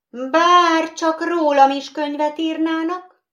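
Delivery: high, wistful sigh; long for it with a sigh; sighing wish. Acted. Doll wishing for her own book, sighing. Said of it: "sóhajtott a porcelánbaba".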